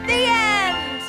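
high, projected curtain line; grand announcement. Acted. Actress closes the play for the audience, grand announcement. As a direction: proclaim it to the audience.

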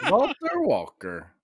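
put-on high, slow Russian accent with laughter; hammed-up Russian accent, laughing; mock Russian accent. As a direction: draw the name out in playful Russian. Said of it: "you did a Russian accent".

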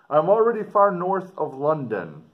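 dramatic reading. Acted. Teacher reading the explorer's letter aloud to students, expressive. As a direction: read it out to the class with feeling.

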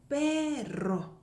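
"perro" stretched out with a trilled rr; rolled r. Shown as a demonstration. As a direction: hold "pe", then roll into "rro" of "perro". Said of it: "굴리는 발음인데".

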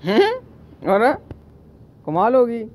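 high, loud startled call; sudden surprise at the monkey; loud surprised exclamation. Real, not acted.

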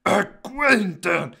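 high, loud character voice; young fighter's voice. Pretend. Young fighter questions the practice dummy, high and loud. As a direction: pitch it up and protest loudly. Voicing Conrad in the game.

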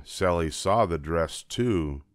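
ordinary delivery

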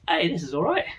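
usual speaking voice

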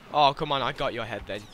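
ordinary talk, nothing marked

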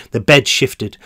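plain reading voice

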